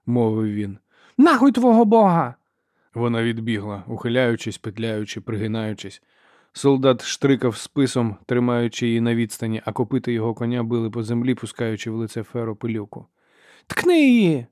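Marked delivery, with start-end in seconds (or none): 1.2-2.3 high, very loud angry curse; defiant yell. A narrator's reading. Ferro curses the pious soldier, yelling defiantly. 13.7-14.5 high, loud urging yell; woman shouting. A narrator's reading. Sister urges soldier to stab, shouting. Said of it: "почула вона голос жінки".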